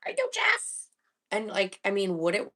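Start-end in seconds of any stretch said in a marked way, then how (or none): 0.1-0.9 squeaky high bird voice. Pretend. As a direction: chirp it like a tiny helpful bird. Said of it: "the bird will be like".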